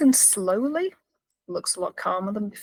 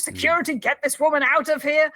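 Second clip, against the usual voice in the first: louder, higher mock call for help; retold shout; 6 semitones higher, 5 dB louder than usual. Prisoner shouting for security, half-voiced. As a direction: imitate calling urgently for the guards. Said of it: "He's shouting for security now".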